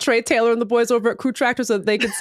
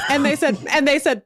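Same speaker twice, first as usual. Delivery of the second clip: ordinary talk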